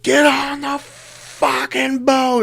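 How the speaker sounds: high, slow, drawn-out yell of a guide; mock guide yell. Boat guide yelling at swimmers to get on board, drawn-out high yell. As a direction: belt it like a panicked boat crew calling.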